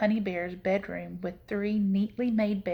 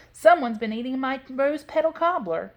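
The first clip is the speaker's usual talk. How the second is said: higher middle-sized bear voice; Mama Honey Bear; 5 semitones higher, 4 dB louder than usual. A narrator's reading. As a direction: complain as an indignant mother bear, pitched up. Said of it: "in her middle-sized bear voice".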